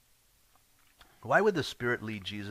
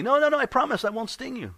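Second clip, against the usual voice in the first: very high, louder pleading; pleading scorpion; 14 semitones higher, 6 dB louder, faster than usual. Acted. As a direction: insist over and over, begging. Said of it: "He says".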